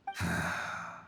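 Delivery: a low, weary sigh; heavy sigh. Acted. Ghost sighing over his comrade's suffering, heavy sigh. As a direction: let out a sorrowful breath. Grieving yaksha's burdened sigh.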